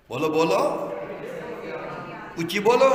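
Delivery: loud prompting, rising in pitch at the end; loud urging. Real, not acted. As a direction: call out to rouse the congregation. Urging congregation to answer louder.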